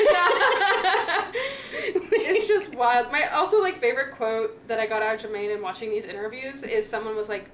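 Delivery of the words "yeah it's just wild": laughing while talking. Real, not acted. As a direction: laugh through the words. Laughing after the nerd story.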